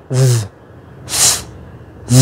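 "v" as a long buzzing sound; vibrating V. Shown as a demonstration. Teacher showing students how V vibrates, voiced and drawn out. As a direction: hold "V" with the vocal cords vibrating. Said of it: "âm V là rung".

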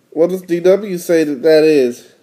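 ordinary delivery, nothing marked

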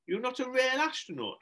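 small high questioning voice; Marcus asking. Acted. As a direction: ask it as a disillusioned little boy. Child asks Shelley in disbelief.

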